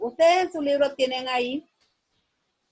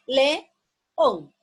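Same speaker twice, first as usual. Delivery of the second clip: león split into two beats, slow and loud; slow demo in syllable beats; 6 dB louder than usual. Shown as a demonstration. Teacher showing pupils the two syllables of león. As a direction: tap out le-ón in two strokes of voice. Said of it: "En dos golpes de voz".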